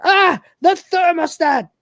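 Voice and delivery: high, slow, loud cry of dismay; panicked high shout. Retold or imitated. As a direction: yell it at the ruined uniform. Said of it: "he shouts".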